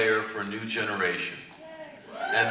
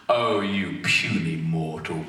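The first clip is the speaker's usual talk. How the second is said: slow and scornful, mildly lower; lightly voiced arrogant god; 6 semitones lower, slower than usual. Zamasu sneering at Spike, slow lightly lowered scorn. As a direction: look down on a puny mortal, unhurried. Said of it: "What would that be like".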